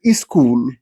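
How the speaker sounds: "iskul" said with an added short i before the s-k; Indian English school. Shown as a demonstration. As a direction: say "iskul" as i-skool. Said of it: "school is pronounced as iskul".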